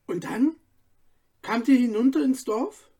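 a hint of a curious young girl; girl Sabine. Sabine asks Stefan about the forest, a lightly voiced eager girl.

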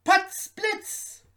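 high, punchy tailor voice; small tailor exclaiming in a high voice; tailor's startled exclamation. A narrator's reading. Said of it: "rief der Schneider".

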